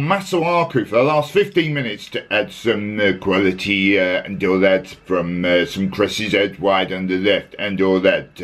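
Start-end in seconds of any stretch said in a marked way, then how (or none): 1.7-8.4 put-on lowered voice in a clumsy French accent; mock French accent. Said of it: "I can only do a French accent".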